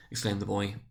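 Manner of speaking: plain reading voice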